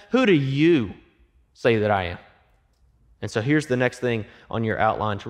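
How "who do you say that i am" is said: "you" leaned on, slight pause after it; stress on YOU. Shown as a demonstration. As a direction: land hard on "you" in "Who do you say that I am". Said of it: "You hear the power behind that?".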